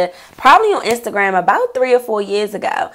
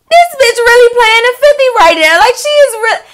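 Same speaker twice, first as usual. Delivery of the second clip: much higher, louder mock-outraged squeal; shocked disbelief; 12 semitones higher, 4 dB louder than usual. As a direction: squeal it, scandalized. Realizing friend's betrayal.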